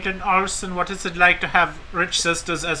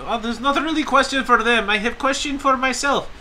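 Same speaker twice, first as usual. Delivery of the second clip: much higher broken-English put-on voice, laughing; mock foreign accent; 9 semitones higher than usual. Podcaster plays a would-be model fan at a panel in a mock accent. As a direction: pitch yourself like a hopeful model.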